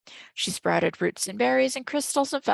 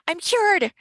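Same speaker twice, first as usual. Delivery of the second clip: high joyful shout; 10 semitones higher, 7 dB louder than usual. A narrator's reading. Girl shouting to the room she's cured, joyful. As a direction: burst out with the good news. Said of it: "she shouted".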